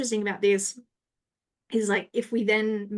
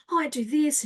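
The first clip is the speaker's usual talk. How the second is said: confident; 6 semitones higher than usual. Retold or imitated. Confident networking self-introduction.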